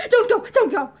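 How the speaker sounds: high, loud pleading wail; frantic repeated plea. A narrator's reading. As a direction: wail it in desperation. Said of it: "she wailed".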